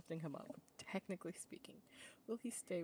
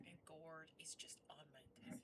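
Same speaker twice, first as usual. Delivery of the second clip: hushed whisper so the cats sleep on; soft unvoiced whisper; 2 semitones lower, 16 dB quieter than usual. Real, not acted. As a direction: breathe it without voice. Whispering, not to wake cats.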